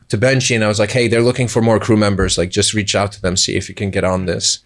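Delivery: usual speaking voice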